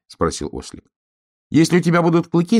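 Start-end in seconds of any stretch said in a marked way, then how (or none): 1.5-2.6 high, quick star voice; insistent star. A narrator's reading. Star persuading donkey to have fangs, high insistent voice. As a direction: talk someone into it, high and fast.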